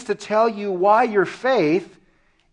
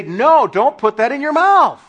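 loud, high acted reprimand; forceful mock-parental no; scolding parent; 7 semitones higher, 8 dB louder than usual. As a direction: scold like a parent stopping a toddler. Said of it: "parents are meant to come up to their children".

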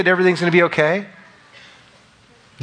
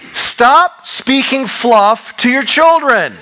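much higher, louder and slow, hammering each word; preaching emphatically; 10 semitones higher, 5 dB louder, much slower than usual. Real, not acted. Preacher urging parents, slow forceful emphasis. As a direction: drive the point home to the congregation.

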